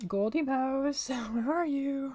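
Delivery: high, drawn-out search call; sing-song calling. Pretend. Player looking for the golden mouse, sing-song calling. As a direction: call out slowly for a hidden creature.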